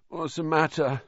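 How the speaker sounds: high, groggy just-woken mumble. A narrator's reading. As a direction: ask as if shaken out of sleep. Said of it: "said Harry groggily".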